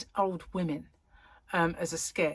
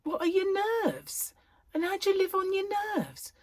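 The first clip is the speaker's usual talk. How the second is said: put-on puzzled child; 13 semitones higher than usual. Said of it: "I used to think".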